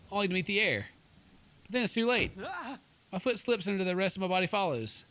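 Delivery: normal talking voice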